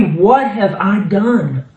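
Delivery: high, emphatic reproach voiced as God; preacher declaiming God's grievance; God's wounded appeal. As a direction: demand an answer from those who turned away. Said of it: "And he is saying in verse three".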